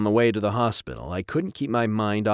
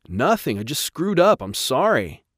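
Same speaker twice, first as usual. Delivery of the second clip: high, loud protesting admission; upset outburst; 6 semitones higher, 5 dB louder than usual. Acted. Rob owning up to his scolding father, upset and loud. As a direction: blurt it out under pressure.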